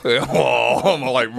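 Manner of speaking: ordinary delivery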